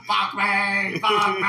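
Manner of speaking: a loud, put-on cry; mock cry. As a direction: howl it in mock horror. Reacting to the parrot bit.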